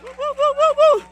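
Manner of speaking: high, whooping cheer; cheering woos. Real, not acted. Host cheering the crowd for the new panelist, high whoops. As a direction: whoop for the guest like a fan.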